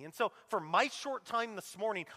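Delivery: ordinary delivery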